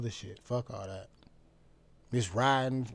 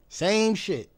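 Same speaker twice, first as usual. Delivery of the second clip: exasperated emphasis; 7 semitones higher, 6 dB louder than usual. Real, not acted. Ranting about nothing changing.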